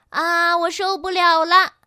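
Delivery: high, loud wail of impatience; exasperated complaint. A narrator's reading. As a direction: cry out as if you can't stand it. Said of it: "云宝抱怨道". (受不了地大声抱怨)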